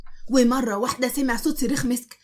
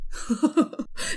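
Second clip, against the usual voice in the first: laugh; 3 semitones higher than usual. Acted. Both burst out laughing.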